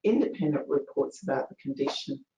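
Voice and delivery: usual speaking voice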